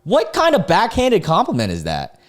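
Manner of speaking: astonished, pitched right up; incredulous outburst. Real, not acted. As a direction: react in high-pitched disbelief. Incredulous reaction to backhanded compliment.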